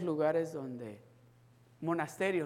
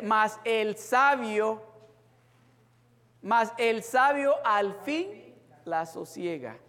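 louder, slower sermon delivery; preaching; 5 semitones higher, 6 dB louder, slower than usual. Acted. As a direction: lay out the verse with weight. Preaching Proverbs verse again.

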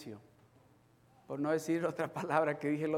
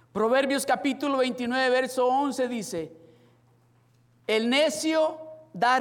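loud, raised sermon reading verses; preaching; 8 semitones higher, 9 dB louder than usual. Acted. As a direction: proclaim the verses to the church.

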